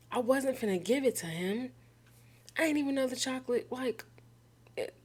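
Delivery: fast, high rush of excuses; flustered defense. Real, not acted. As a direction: blurt the excuses out in a panicked hurry. Defending herself to the cat.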